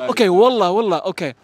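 ordinary talk, nothing marked

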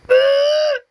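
high loud booing. Real, not acted. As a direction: boo it out high. Booing in game.

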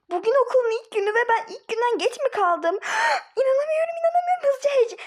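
high, loud frantic panic about being late; breathless high-pitched alarm; panicked shriek. Acted. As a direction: shriek it like you've overslept on the first day.

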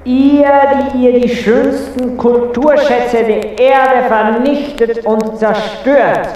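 high, scornful theatrical tirade; indignant scolding. Acted. Indignant ruler berates the human. (empörte, theatralische Standpauke)

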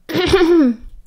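a deliberate, commanding throat clear. A narrator's reading. Dumbledore clears his throat to command the room's attention. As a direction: clear the throat to call the room. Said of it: "who cleared his throat".

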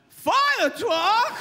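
high, loud thrilled question; delighted to hear of a fire truck; excited passenger asking about the fire truck. Acted. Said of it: "one guy in the back of the plane went".

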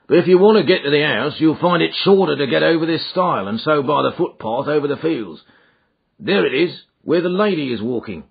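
brisk rustic driver voice; helpful country driver. A narrator's reading. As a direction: rattle off directions as a local driver. Driver gives directions.

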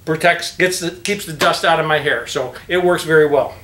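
muffled behind fabric covering; speaking with mouth covered; through a cloth mask. Real, not acted.

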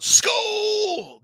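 high yelled Skull; mock yell. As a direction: shout it out like a heckler. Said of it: "John's yelling out".

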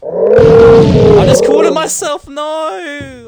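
high, slow, loud scream. Real, not acted. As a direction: belt it out in a long scream. Sudden screamed repeat.

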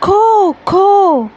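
high cawing crow shout. Acted. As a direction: squawk high and sharp like a crow. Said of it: "the crow shouted".

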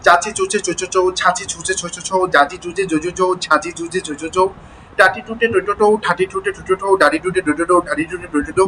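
quick rattle of syllables in even groups; fast drill reading. Shown as a demonstration. Teacher showing how to read once the mouth loosens, fast reading. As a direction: run the syllables together at speed. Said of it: "দ্রুততার সাথে পড়তে হবে".

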